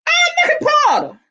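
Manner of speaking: high-pitched, delighted squeal of recognition; excited high whoop; excited greeting. Real, not acted. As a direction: call out way up high, thrilled to spot a friend. Spots Porter arriving, calls out.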